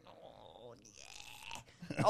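breathy uneasy oh yeah; nervous whisper. Retold or imitated. Young salesman to creepy old man, hushed reluctant yes. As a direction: agree under your breath, uneasy. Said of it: "And I was like".

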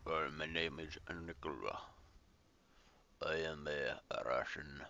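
badly faked Russian captain; hammy, unconvincing Russian accent; terrible Russian accent. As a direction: put on a clumsy Russian accent. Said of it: "That was a terrible Russian accent".

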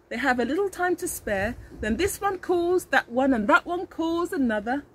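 ordinary delivery

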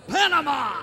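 loud, high victory shout; ringing, high heroic exclamation; triumphant cry. Acted. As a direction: crow it out like you've just won. Hero's triumphant final line.

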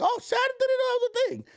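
high shocked exclamation; surprised. Retold or imitated. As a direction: gasp it out in disbelief. Retold own shocked reaction.

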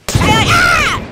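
very high, loud scream; startled scream. Acted. Man hit by lightning, screaming. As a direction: cry out as if suddenly struck.

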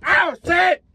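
high, loud startled yelps; panicked cursing. Retold or imitated. Trucker reliving slamming his brakes, loud high panic. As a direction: yelp as the brakes lock. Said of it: "and I was like".

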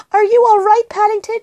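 put-on anxious girl calling; shouted question. A narrator's reading. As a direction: call out high to check on someone. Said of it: "she shouted".

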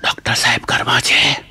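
slow, low hoarse whisper; imitating a voiceless rasp; lost voice. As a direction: ask with the voice gone. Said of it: "મેં બેઠેલા અવાજે પૂછ્યું".

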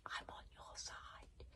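a near-silent unvoiced whisper; mouthed. Girl mouthing reassurance to the boy, near silent. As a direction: barely breathe the words. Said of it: "Wish was mouthing something".

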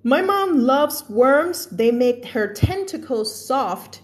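slow, drawn-out read; slow story reading. A narrator's reading. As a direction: read it slowly to the class. Rereads story line. (อ่านนิทานช้าๆ)

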